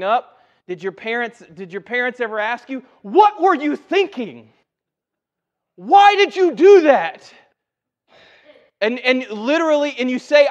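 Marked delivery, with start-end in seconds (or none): 3.0-7.3 high, loud, drawn-out scolding; exasperated parent. Acted. Parents asking a child for reasons, exasperated scolding. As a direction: demand it slowly at a near shout.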